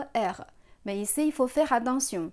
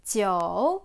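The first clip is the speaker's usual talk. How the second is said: "Jiu" dipping low, then rising; third tone; 3 semitones lower, 4 dB louder than usual. Shown as a demonstration. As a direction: stretch "Jiu" down and back up. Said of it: "Troisième ton".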